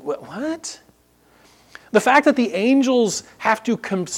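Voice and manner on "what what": incredulous. Retold or imitated.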